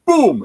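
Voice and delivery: loud, high-pitched mock bang; imitated gunshot boom. Storyteller mimics the bang in the woods, loud boom. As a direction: burst out with a sudden gunshot noise.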